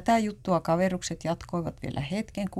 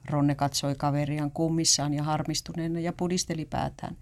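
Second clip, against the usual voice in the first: plain reading voice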